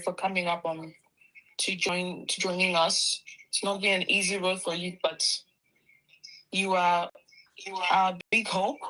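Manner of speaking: ordinary talk, nothing marked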